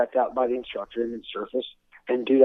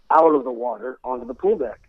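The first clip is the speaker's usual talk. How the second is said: ordinary delivery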